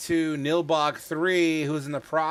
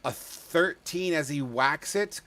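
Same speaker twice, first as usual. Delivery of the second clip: usual speaking voice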